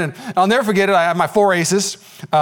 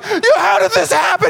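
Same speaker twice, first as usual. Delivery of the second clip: mock crying; 14 semitones higher than usual. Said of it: "He starts crying".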